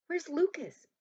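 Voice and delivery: high, loud anxious question; urgent alarm. A narrator's reading. Rescued girl asking after missing Lucas, urgent alarm. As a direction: demand to know, voice jumping up.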